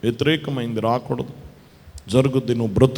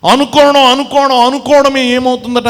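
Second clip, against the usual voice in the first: much higher, much louder repeated cry; preaching shout; 11 semitones higher, 9 dB louder than usual. Acted. Preacher crying out to the congregation about believing, loud and high. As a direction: hammer the word home at full voice.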